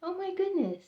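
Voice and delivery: high awed gasp of words; stunned amazement. Real, not acted. Clerk finding the clock in the bag, stunned and awed. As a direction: overcome by the coincidence. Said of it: "I had chills from head to toe".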